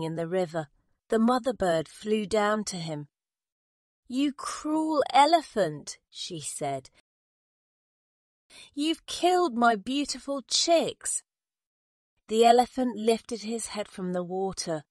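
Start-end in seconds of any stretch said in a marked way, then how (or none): 4.1-6.0 high, drawn-out reproach; angry accusation. A narrator's reading. Mother bird to the elephant, high, wounded accusation. 8.7-11.2 tag. A narrator's reading. Mother bird accuses the elephant.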